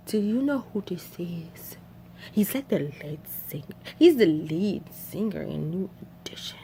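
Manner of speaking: hushed whispering aside; unvoiced hushed whisper. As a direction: whisper it secretly to her friend. Girl whispering in car.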